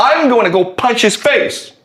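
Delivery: a touch of indignant anger; mildly angry. Acted. Bully vowing revenge to his friend, mildly angry. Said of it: "gets really angry and says".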